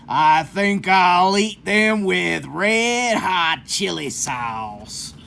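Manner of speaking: slow, lightly voiced sly coyote; gloating coyote.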